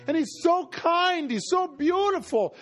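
high-pitched, overwhelmed wonder; acted frightened amazement; scared awe. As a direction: describe it in trembling awe, pitch held high. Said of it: "but I'm scared because".

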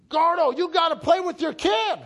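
loud, high, demanding shout; mother yelling. Retold or imitated. Mom in the kitchen to dad about son, yelling. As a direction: yell at your husband in full force. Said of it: "fighting and yelling at each other".

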